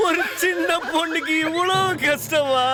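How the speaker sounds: high, drawn-out tearful complaint; mock wailing. Player moans about a hard Candy Crush, wailing. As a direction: whine it out slowly, pitched high. Wailing over a lost game.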